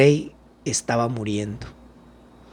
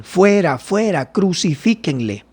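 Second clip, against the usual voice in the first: raised, higher voice, restrained crowd cry; higher-pitched cries, only lightly shouted; mild reading of shouts; 6 semitones higher than usual. A narrator's reading. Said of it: "Pero ellos gritaron".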